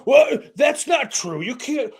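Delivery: sneering impression of a pedantic host; mocking whiny scold. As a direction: ham up a prissy fact-checker, slowing down on the last words. Mocking Joe's nitpicking of comedians.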